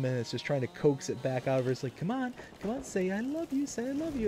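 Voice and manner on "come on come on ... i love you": high, sing-song coaxing; coaxing baby talk. Retold or imitated. Parents coaxing their baby to say it, high sing-song. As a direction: sweet-talk the baby into saying it.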